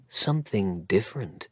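ordinary delivery, nothing marked

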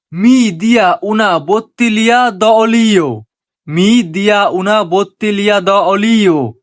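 very slow, word by word Italian phrase; slow teaching demo. Shown as a demonstration. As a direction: spell it out slowly for learners. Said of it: "Italian ভাষায় বলবো". (ধীরে ধীরে শেখানোর উচ্চারণ)

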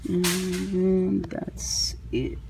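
very slow, drawn-out, quiet; let-down verdict. Real, not acted. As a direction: stretch it out softly in disappointment. Disappointed by empty shelf.

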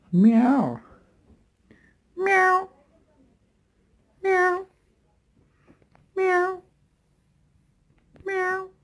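high, squeaky cat meows; high meowing. Pretend. Vlogger meowing high at the cat. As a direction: call to the cat with a high "Meow".

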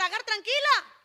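high, loud call to calm her; acted emphatic reassurance. God telling Agar not to worry, loud emphatic reassurance. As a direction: reassure forcefully, voice lifted.

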